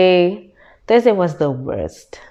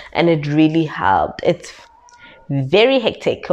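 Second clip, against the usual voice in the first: normal talking voice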